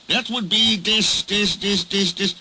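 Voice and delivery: stuck on a word. Acted.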